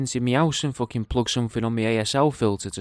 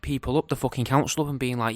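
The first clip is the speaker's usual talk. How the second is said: ordinary talk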